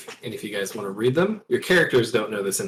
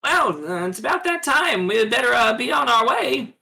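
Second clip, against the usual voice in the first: Western character; 13 semitones higher than usual. Acted. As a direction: shout it like a cartoon frontier voice. Character in Western radio drama.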